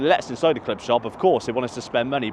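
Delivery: ordinary talk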